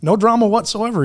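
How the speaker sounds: high, breezy, carefree listing. Retold or imitated. To the baffled agent, high breezy carefree brag. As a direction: toss it off cheerfully.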